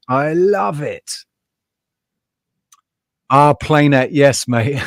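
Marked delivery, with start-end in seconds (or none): 0.1-1.1 higher and slow, savouring it; loving every gross bit; gleeful relish. Real, not acted. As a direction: stretch it out with delight. Repeats line, quiet then raised.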